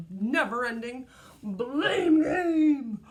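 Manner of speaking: loud, slow, drawn-out blame game; dramatic emphasis. A narrator's reading. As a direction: lean hard into the words. Dramatic reading climax.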